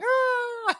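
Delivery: very high comic wail; hammed-up "aaah" scream; mock falling scream. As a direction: imitate the bridge keeper being flung off, high-pitched. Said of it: "you see the bridge keeper going".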